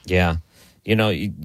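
ordinary talk

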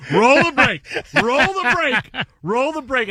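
high, urgent cue to the crew; excited rush. Retold or imitated. Producer rushing the crew to roll the break, high and urgent. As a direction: hurry the break in, half-panicked. Control room calling for a break.

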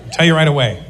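normal talking voice

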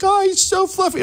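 very high, squealing fawning imitation; gushing vet nurse. Host imitating a vet nurse adoring his dog, high mock gushing.

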